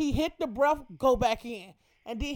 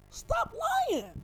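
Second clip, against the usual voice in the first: incredulous squeal. Real, not acted. Calling out the rapper.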